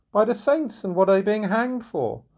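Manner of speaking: high, surprised outburst; astonished. Acted. Robin stunned by the hanging charge, high and surprised. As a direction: exclaim in disbelief.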